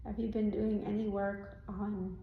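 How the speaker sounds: normal talking voice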